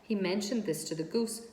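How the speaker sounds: usual speaking voice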